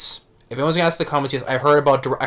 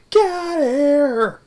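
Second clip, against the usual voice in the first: high, slow, louder "Get out of here"; hammed-up high, slow old-man yell; cranky old shout; 4 dB louder, much slower than usual. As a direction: drag it out like a grumpy old man shooing someone. Angry neighbour shooing.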